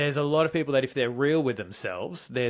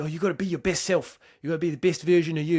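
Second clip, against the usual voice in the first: mock-preachy, higher and louder, quick at the end; mocking self-help preacher; 5 semitones higher, 5 dB louder than usual. A funny Kiwi guy mocking self-help gurus, higher and louder. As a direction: parrot the motivational gurus in a mocking voice. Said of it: "they tell you".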